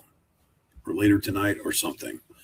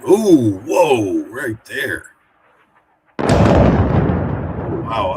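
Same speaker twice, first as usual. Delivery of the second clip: sudden loud oh, whoa, wow; startled by a blast; 7 dB louder, much slower than usual. Real, not acted. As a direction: jolt at the explosion. A big explosion seen live.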